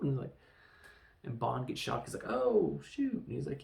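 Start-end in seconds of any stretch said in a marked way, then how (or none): 2.3-3.2 high-pitched, hammed-up "oh, shoot"; imitated startled reaction; mock startled. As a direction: yelp it like a mock zap victim. Acts out Bond getting shocked.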